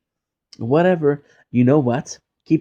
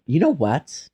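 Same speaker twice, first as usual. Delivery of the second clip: ordinary talk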